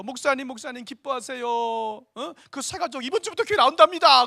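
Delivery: high, building to a loud announcement; gleeful imitation of an excited caller; excited good news. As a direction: break joyful news to the pastor, voice climbing. Acts evangelist phoning with good news.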